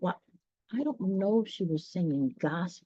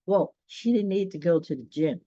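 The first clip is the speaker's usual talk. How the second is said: plain reading voice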